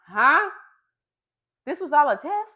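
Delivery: high, loud incredulous huh; shocked, as if caught out; stunned that it was all a test; surprised disbelief. Retold or imitated. Reacting in disbelief.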